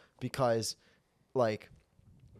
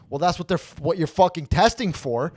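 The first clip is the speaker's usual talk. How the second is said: loud, raised indignant rant at the instructor; angry rant; 4 semitones higher, 7 dB louder than usual. Retold or imitated. Ranting at the motorcycle instructor, loud and indignant. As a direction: let him have it, voice up and loud. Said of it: "I'm like".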